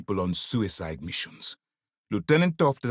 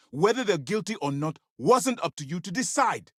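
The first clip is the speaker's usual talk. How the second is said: high-pitched, insistent scolding; commander's stern telling-off; indignant rebuke; 8 semitones higher than usual. Acted. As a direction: reprimand an agent who overstepped. Commander rebukes agent over deaths.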